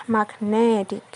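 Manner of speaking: "magnetic" with the middle syllable strong; stress on NET. Shown as a demonstration. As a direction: move the weight to NET: mag-NE-tic. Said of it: "stress move from mag to net".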